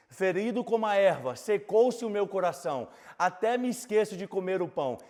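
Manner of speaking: loud, high preaching of the psalm; fervent pulpit preaching voice; full-force preaching. Acted. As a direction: preach it out over the congregation. Preaching with scripture reading.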